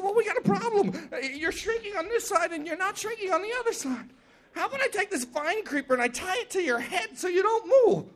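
high, light, chattering voice; small trickster talking fast to persuade; scheming little Anansi. Acted. Anansi talks python into being tied, high chatter.

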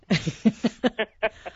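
high, loud laugh; real laugh breaking out mid-reading; burst of laughter. Real, not acted. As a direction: crack up laughing. Reader cracks up at own book.

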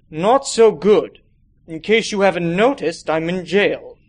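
lightly voiced weary old man, slow; a hint of a worn-out Santa; grumpy old man, lightly done; tired old Santa.

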